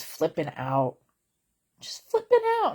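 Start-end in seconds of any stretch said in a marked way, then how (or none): 1.8-2.7 leaps much higher and louder; high exclaim. Real, not acted. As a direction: squeal the word flipping. Describing angry caller.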